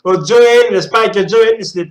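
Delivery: loud, quick delighted exclaim; loud excited outburst. Real, not acted. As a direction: blurt it out thrilled. Excited about Greek character.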